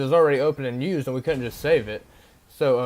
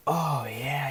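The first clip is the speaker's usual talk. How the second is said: much softer, hushed stranger voice; imitating the paint guy; 4 dB quieter than usual. Teller imitates the man at the dumpster, hushed and soft. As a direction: half-whisper him like a spaced-out drifter. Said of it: "I swear this is how he talks".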